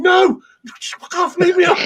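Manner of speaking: high, hammed-up protest; high-pitched mock exasperation; retold exasperated refusal. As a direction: beg to be left alone in comic desperation.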